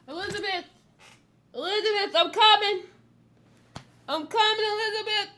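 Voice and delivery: high, loud calling out to Elizabeth; Fred Sanford impression. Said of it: "Sanford and Son".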